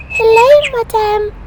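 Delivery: Peppa voice. Acted. Peppa voiced in read story.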